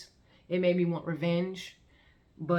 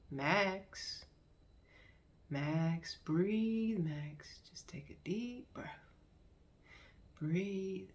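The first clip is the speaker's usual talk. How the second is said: soft, hushed coaxing whisper; calm soothing whisper; 2 semitones higher, 7 dB quieter, much slower than usual. Retold or imitated. Narrator kneeling beside Max, softly urging him to breathe. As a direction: gently coax him to breathe.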